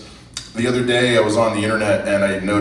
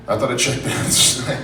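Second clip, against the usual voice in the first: breaking into a laugh on the words. Real, not acted. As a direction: repeat it while cracking up. Repeats the joke through a laugh.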